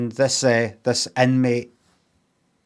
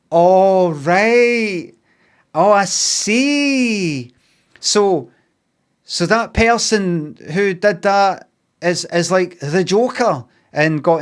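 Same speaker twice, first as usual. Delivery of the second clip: high, loud, slow drawn-out oh right; milk the dawning realisation, hammed up; mock realisation; 6 semitones higher, 5 dB louder, much slower than usual. Viewer's dawning realisation, mocked.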